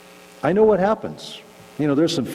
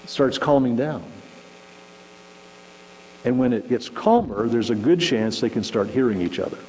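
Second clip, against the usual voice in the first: ordinary talk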